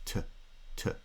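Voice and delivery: "t, t" as two short, separate "tuh" sounds; schwa syllable. Shown as a demonstration. Lecturer repeats today's first syllable, reduced to schwa. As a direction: clip "t" to an unstressed schwa syllable. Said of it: "vowel that is reduced to schwa".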